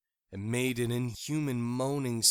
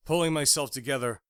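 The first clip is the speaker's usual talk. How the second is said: usual speaking voice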